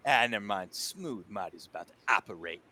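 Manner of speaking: lightly voiced self-styled smooth operator; Marty in character. Game master as Marty talking himself up, lightly in character. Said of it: "He's like".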